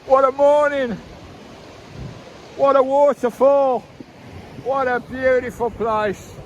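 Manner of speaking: high, loud, thrilled exclamations; awed excitement. Real, not acted. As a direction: gasp it out in wonder. Awed at the waterfall.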